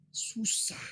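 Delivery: breathy whisper, barely voiced; whispered admission. Boy tells the preacher he can't forgive, whispered. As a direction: let the word slip out on a whisper.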